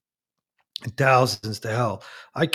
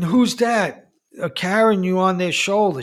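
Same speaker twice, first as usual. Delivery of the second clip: higher, startled question to the ghost; frightened wife; 6 semitones higher, 3 dB louder than usual. Retold or imitated. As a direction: ask it in a mild fright.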